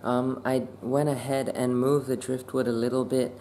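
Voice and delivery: soft late-night hush; whispering. Real, not acted. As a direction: keep it very soft, fish are sleeping. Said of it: "I have to whisper".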